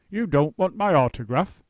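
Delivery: loud, astonished disbelief; the great actor cannot believe it; surprised voice. A narrator's reading. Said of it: "he said in a surprised voice".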